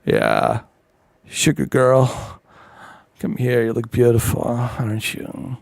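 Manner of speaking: smooth talker from Texas. Retold or imitated. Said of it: "Some guy out there from Texas".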